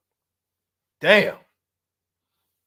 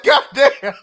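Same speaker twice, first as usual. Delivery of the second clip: laughing exclamation; 9 semitones higher, 9 dB louder than usual. Retold or imitated. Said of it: "I was like".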